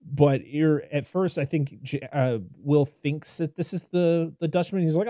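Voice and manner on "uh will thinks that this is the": ordinary talk, nothing marked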